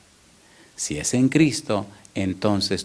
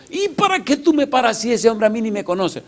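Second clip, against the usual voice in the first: indignant bandit; 11 semitones higher than usual. Retold or imitated. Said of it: "le dice el otro".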